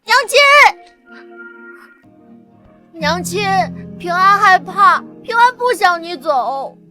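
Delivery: put-on child's pleading cry. Child begging mother not to leave, frightened pleading.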